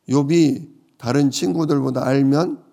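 ordinary delivery

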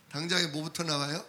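ordinary delivery

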